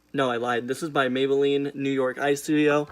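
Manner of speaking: ordinary talk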